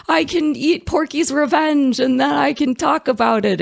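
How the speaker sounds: high, bright, excited retelling; a squeal of retold delight; giddy excitement. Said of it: "I was so excited".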